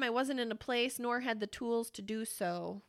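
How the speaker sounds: ordinary delivery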